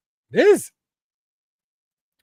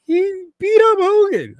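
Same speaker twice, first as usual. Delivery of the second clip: very high, slow wailing sob; a hammed-up heartbroken child; mock crying; 7 dB louder than usual. Fan imitating his childhood tears, mock wail. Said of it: "I was a mess".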